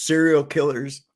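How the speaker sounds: usual speaking voice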